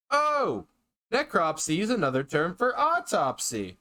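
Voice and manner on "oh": sudden high yelp of surprise; startled oh. Real, not acted. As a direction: jump at the shock. Startled by the monster.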